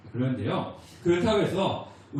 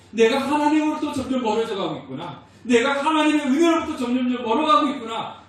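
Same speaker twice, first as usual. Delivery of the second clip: higher, louder lament; 6 semitones higher, 6 dB louder than usual. Retold or imitated. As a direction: cry out in grief at drifting away. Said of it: "애통하는 모습".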